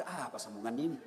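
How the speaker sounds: low, soft thinking aloud; pondering. Retold or imitated. Stuck imam trying to recall the next verse, low puzzled murmur. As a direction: drop right down as if searching your memory. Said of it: "Lama dia pikir".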